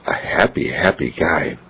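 soft whispered comment; hushed tender aside. A narrator's reading. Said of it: "narrator comment".

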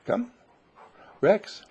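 high, carrying call to a dog; calling from far away. Real, not acted. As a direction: sing out the dog's name high.

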